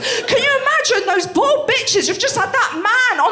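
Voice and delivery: high, loud, slow comic outrage; outraged rant. Real, not acted. Comic to crowd about Hancock, loud slow outrage. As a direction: build the disbelief into a shout.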